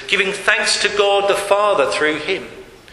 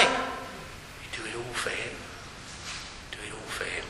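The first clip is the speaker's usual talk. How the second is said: soft appeal; 9 semitones lower, 17 dB quieter than usual. Real, not acted. Preacher urging the church, softly intimate. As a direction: draw them in quietly.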